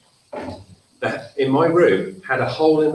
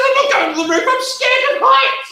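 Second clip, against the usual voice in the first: much higher, loud, half-laughing refusal; panicky maid; 6 dB louder than usual. Acted. Betty, frozen on the stairs, refuses in a high panicky voice. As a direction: squeal it like a frightened maid.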